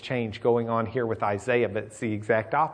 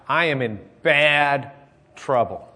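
retold wailing cry; 2 semitones higher, 4 dB louder, slower than usual. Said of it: "That's a wailing, crying out".

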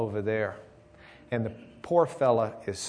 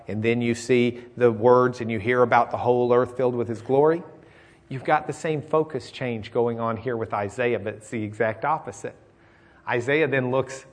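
ordinary talk, nothing marked

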